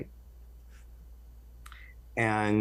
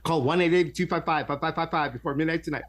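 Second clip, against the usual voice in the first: fast, high TV ad pitch voice; infomercial voice; 6 semitones higher, faster than usual. Pretend. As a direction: rattle it off like a late-night ad. Mock psychic hotline ad.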